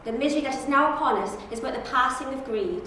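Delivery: loud, rousing speech, big pauses; prepared audition speech. Acted. Auditioner giving his prepared speech, loud and rousing. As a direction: proclaim it to a crowd. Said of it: "a little speech that you have prepared".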